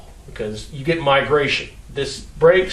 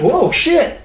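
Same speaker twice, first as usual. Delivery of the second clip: startled driver; 11 semitones higher than usual. Retold or imitated. Driver startled as wheel shakes.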